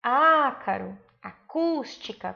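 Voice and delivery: "Ácaro, acústica" with long, strong Á and CÚS; stress on antepenultimate syllable. Shown as a demonstration. Teacher showing pupils the tonic syllable, stressing Á and CÚS in "Ácaro, acústica". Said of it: "Percebam que é a antepenúltima sílaba tônica".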